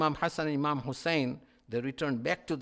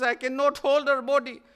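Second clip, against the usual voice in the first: higher, lamenting echo of the cry; grieving repeat; 9 semitones higher, 7 dB louder than usual. Acted. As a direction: repeat it as a mournful refrain.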